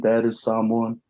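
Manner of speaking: ordinary talk, nothing marked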